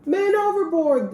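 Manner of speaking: loud, high alarm cry; sailors crying out. A narrator's reading. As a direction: shout the alarm to the crew. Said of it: "the sailors cried".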